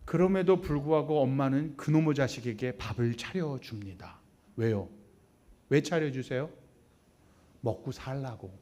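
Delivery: ordinary talk, nothing marked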